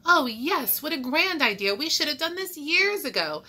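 high, loud, swept-up enthusiasm; excited crowd. A narrator's reading. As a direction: gush over the grand idea. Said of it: "A wave of excitement swept through the room".

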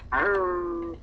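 normal talking voice

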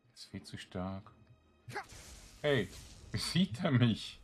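ordinary talk, nothing marked

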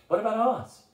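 high-pitched, incredulous objection; gaping astonishment. A narrator's reading. Gargoyle shocked at abandoning their friend, aghast. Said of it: "the gargoyle gaped".

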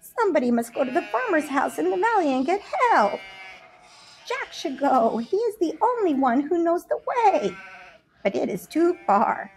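put-on high, worried old sheep voice; an anxious elder ewe thinking aloud; older sheep worrying about how to get help; fretful older sheep. Older sheep speaking at length.

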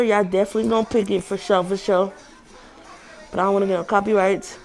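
muffled behind surgical mask; speaking with covered mouth; through a face mask. Real, not acted.

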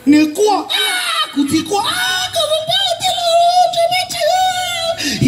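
high wailing shout, crying out. Retold or imitated. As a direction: wail the words in grief, pitched high. Uncle wailing at funeral.

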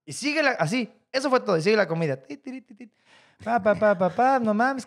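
ordinary talk

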